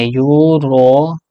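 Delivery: European stretched very slowly, syllable by syllable; European drawn out for the students; drawn-out demo. Shown as a demonstration. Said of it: "कैसे लिखेंगे?".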